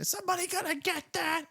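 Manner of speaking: high, scolding wife voice; shrill nagging wife. Retold or imitated. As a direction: yell it from the next room. Angry wife voiced in background.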